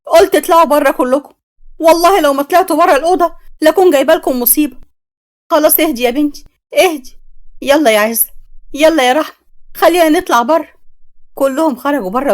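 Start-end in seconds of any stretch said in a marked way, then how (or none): 0.1-4.8 higher and louder, tearful yelling; screaming at everyone to get out, in tears; crying angry shout. A narrator's reading. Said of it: "عيطت مي واتعصبت وقالت له". 5.5-10.7 urgent calming. Acted. Mother calming, urging everyone out.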